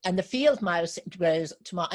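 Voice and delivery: usual speaking voice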